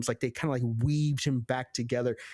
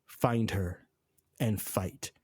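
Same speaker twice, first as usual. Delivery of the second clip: flat, lower, dry delivery straight to camera; deadpan; 5 semitones lower, 4 dB quieter, much slower than usual. Retold or imitated. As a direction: drop all emotion and just state it. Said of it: "straight deadpan to the camera".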